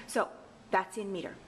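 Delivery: normal talking voice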